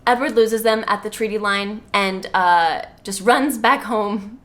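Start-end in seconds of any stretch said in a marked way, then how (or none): none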